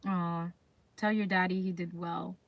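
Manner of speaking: normal talking voice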